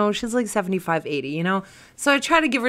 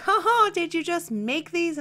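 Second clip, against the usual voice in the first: lightly voiced old lady, higher; mock her fake chuckle; awkward landlord joke; 9 semitones higher than usual. Elderly landlord joking about cash, lightly voiced. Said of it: "her awkward small talk".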